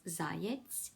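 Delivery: "zayets" drawn out slowly, ZA-yets in Ukrainian; Ukrainian word. Shown as a demonstration. Teacher giving the Ukrainian word for hare, slow and careful. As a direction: spell "zayets" out in sound, very slow. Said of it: "in Ukrainian, hair is".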